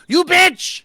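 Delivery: high, full-force yell; furious shout at full volume; top-of-lungs shout. Acted. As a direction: scream it after someone long gone. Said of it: "I shout out at the top of my lungs".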